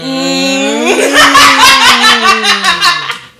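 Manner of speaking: high, loud cackling laugh; long burst of laughter. Real, not acted. Co-host cracking up over an unsayable meme, long loud laugh. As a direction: crack up helplessly. Others hint at controversial meme.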